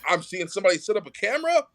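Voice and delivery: ordinary talk, nothing marked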